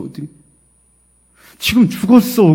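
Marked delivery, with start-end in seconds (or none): 1.6-2.5 loud, high imitation of a grieving parent; raised, anguished voice, acted out; retold tearful outcry. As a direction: cry out the news as the bereaved caller. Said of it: "이분이 막 우는 거야".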